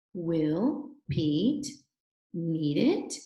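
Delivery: "Will Pete need it?" slowly, rising on "Pete" and "it"; careful reading with a clear upward question tune; question intonation. Shown as a demonstration. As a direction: lift the voice at the end of "need it". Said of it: "your voice goes up".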